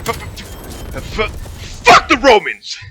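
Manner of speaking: straining stutter bursting into a high shout; held-back sputter erupting into a yell; imitated Tourette's outburst. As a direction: fight to hold it down, then explode loudly. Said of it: "trying to hold his Tourette's down".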